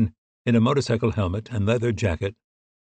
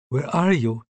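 higher voice with a touch of sleep; lightly acted drowsy woman, pitched up; sleepy; 5 semitones higher, slower than usual. A narrator's reading. Woman woken by her partner's late call, mildly sleepy. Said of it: "her voice heavy with sleep".

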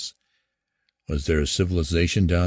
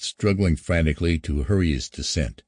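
plain reading voice

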